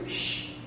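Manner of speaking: Sh as a long hushed hiss; teacher isolating the sh sound. Shown as a demonstration. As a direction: push air through for Sh. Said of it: "mira la C H aquí".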